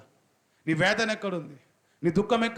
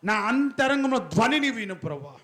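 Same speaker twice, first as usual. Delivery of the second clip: higher, slow fervent prayer line; prayer recitation; 8 semitones higher than usual. Shown as a demonstration. Preacher showing the congregation how to pray, higher and slow. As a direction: pray it out slowly, lifting each phrase. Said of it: "చెప్పాలి ఒకసారి".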